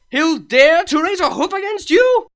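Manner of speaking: high, loud indignant outburst; outraged. Acted. Luna indignant at Shining Armor threatening her sister. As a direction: bristle with protective outrage.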